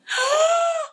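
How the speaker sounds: sharp high gasp of emotion; a real gasp, caught by surprise; sudden gasp. Real, not acted. Overwhelmed on seeing guest.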